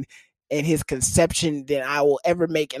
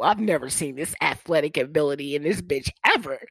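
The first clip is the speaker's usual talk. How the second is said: ordinary delivery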